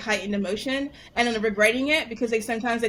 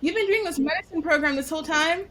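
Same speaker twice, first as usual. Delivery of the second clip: incredulous parent; 6 semitones higher than usual. Retold or imitated. Parents' incredulous question, acted out.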